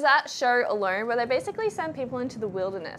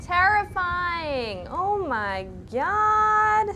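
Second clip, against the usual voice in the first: dazed; 4 semitones higher, much slower than usual. Real, not acted. After dying in the dark.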